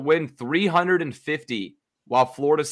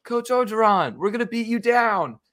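much higher jeering heckler voice; taunting heckler; 10 semitones higher than usual. Retold or imitated. Fan heckling the coach at the stadium, jeering. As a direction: needle the coach from the stands. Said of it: "a heckler who was saying".

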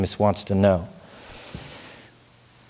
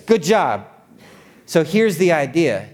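usual speaking voice

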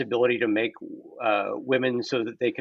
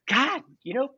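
mock gushing thanks; 11 semitones higher than usual. Retold reply to client.

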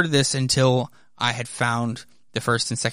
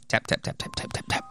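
soft breathy tap tap of typewriter keys; quiet typewriter sound effect; whispered typing taps; 7 dB quieter, faster than usual. Acted.

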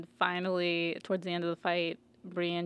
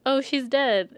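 higher, louder mock reply; mock flat news; 6 semitones higher, 7 dB louder, slower than usual. A man tells searchers the aunt is dead, a mock loud reply. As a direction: toss it off like it's obvious. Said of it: "he's like".

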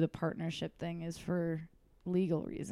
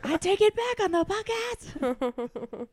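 mock retraction; 11 semitones higher, 4 dB louder, slower than usual. Joking retraction, high put-on voice.

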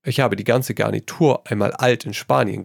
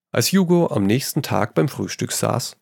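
plain reading voice

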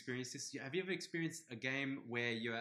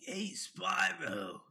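exaggerated surfer, high and very drawn out; over-the-top surfer accent; Hunter; 7 semitones higher, 4 dB louder, much slower than usual. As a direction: stretch it like a surfer dude. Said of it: "a lot more exaggerated surfer".

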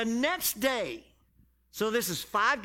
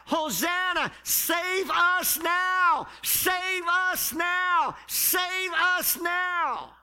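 high, loud, very slow chant of a cry; crowd yelling; 7 semitones higher, 3 dB louder, much slower than usual. Retold or imitated. The multitude cries out to Jesus, slow high shout. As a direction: lift and drag it out like thousands calling. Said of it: "they're yelling and screaming out".

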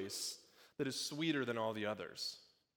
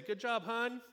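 higher imitated mom cheering her kid on; mom praising; 9 semitones higher, slower than usual. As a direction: cheer like a proud mom at the playground, lightly. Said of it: "you hear mom say".

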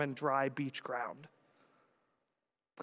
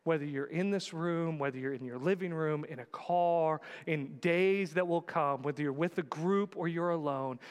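ordinary delivery

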